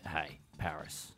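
low, slow and soft chat-up; smooth pickup line. Pretend. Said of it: "and just go".

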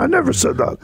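high squeaky puppet voice; Grover voice. Retold or imitated. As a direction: Muppet monster denying it. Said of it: "No, Grover".

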